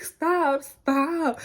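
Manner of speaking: high, loud squeal of disbelief; excited disbelief. Retold or imitated. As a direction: squeal like you just heard great news. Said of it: "I was like".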